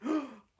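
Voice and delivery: sharp hammed-up gasp; mock gasp. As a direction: gasp in fake horror. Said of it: "Steamed Ham script".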